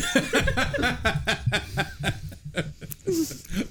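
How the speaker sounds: long, high burst of laughter; big laugh. Real, not acted. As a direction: laugh hard and high at the joke. Long laugh at restaurant joke.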